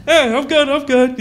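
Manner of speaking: tipsy reassurance. Retold or imitated. Retelling his drunk self.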